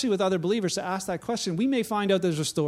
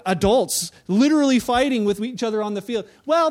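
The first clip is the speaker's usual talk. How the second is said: ordinary talk, nothing marked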